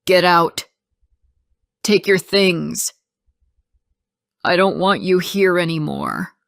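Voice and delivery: loud, forceful order; angry shout at an unwanted guest. A narrator's reading. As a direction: shout it to throw someone out. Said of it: "and shouted".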